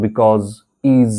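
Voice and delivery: because and is ending in a buzzing z. Shown as a demonstration. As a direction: say "bikawz", "iz" for because, is. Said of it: "এরকম করে একটু উচ্চারণ করবে".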